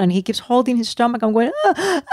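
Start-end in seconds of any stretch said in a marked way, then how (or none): 1.7-2.0 high mock crying whimper; imitating child's cries. Father imitating his son crying in the car, high whimper. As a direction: voice the kid's pained ah, ah. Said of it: "like, crying".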